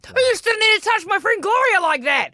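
high-pitched mock yell; retold yelling back. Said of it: "like, yelling back at her".

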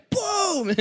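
mock punch sound. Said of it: "he's just like".

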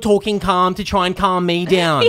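mock teen outburst. Child yelling at calm dad, high, loud, laughing. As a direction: yell it like a fed-up kid. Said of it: "I'd be like".

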